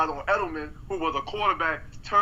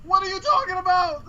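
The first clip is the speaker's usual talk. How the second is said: heated high rant; 10 semitones higher than usual. Real, not acted. Heated defense of Brady.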